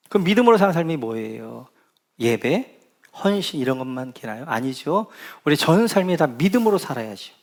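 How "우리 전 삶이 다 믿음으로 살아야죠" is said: usual speaking voice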